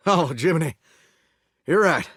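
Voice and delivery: ordinary talk, nothing marked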